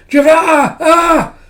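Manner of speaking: high, loud, panicked screams; screaming. Acted. Other cat watches the scientist burn, screaming. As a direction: shriek the name into sustained screams. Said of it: "the other cat just screams".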